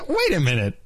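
usual speaking voice

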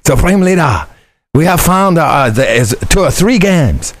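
high, slow hammed-up Chinese accent, words broken and simple; mock Chinese accent. Nephew retelling Uncle Don's spy joke to cohost, hammed-up Chinese accent. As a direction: ham up a Chinese spy's report in a put-on Chinese accent. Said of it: "Just pretend it's Uncle Don with a good Chinese accent".

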